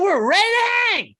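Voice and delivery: put-on high, loud kids' party entertainer; over-the-top clown greeting; Wiggles the Clown. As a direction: announce yourself to children, squeaky and booming. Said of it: "it's Wiggles the Clown".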